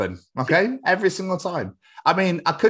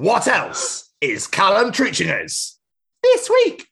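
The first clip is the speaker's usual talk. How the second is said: high, stretched-out announcer call, ending loud; segment announcement; 11 semitones higher, 4 dB louder, much slower than usual. Pretend. Announcing the podcast's segment to listeners, big drawn-out shout. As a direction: belt it out slowly, peaking high.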